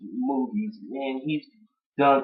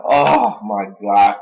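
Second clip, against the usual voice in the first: ordinary delivery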